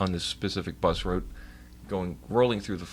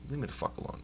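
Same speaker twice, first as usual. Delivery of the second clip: quiet, slightly raised mutter; muttered retort; 4 semitones higher, 10 dB quieter, faster than usual. Retold or imitated. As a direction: grumble it softly, as if to yourself. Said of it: "I don't say it like that".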